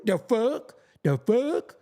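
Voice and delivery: mock question, rising at the end; mimicking dudes' borrowed slang. Mocking dudes who say that to sound current, mimicked rising slang. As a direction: parrot it with an upward lilt. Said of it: "I be seeing dudes say that shit".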